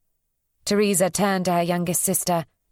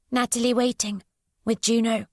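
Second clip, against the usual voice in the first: put-on a withdrawn child speaking in fragments; voiced odd young girl; 6 semitones higher than usual. A narrator's reading.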